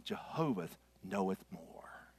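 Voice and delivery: slow, hushed whisper. Acted. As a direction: breathe the hymn line in a whisper. Hymn line, whispered.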